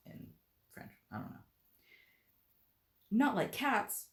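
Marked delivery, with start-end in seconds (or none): 3.1-4.1 high, loud indignant cry; shrill passionate. Acted. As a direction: shriek it in outrage. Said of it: "cried the mouse in a shrill, passionate voice".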